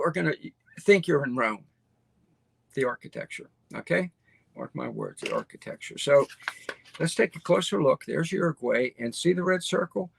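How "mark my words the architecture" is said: drops to a low, hushed, breathy aside; hushed emphasis. Real, not acted. To viewers about the Montevideo architecture, hushed emphatic aside. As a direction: lean in and whisper it like a secret. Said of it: "emphatic hushed aside to viewers".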